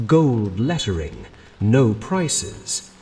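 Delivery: plain reading voice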